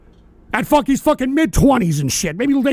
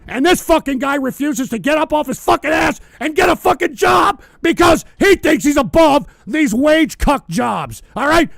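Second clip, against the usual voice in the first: angry shouting; 3 semitones higher than usual. Real, not acted. Streamer yelling at a chatter who lives with his parents, furious shout. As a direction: chew him out at full volume.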